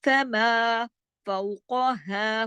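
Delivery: फमा फौकहा with long open vowels; measured, sustained teaching recitation; slow recitation. Shown as a demonstration. Teacher recites lesson words slowly.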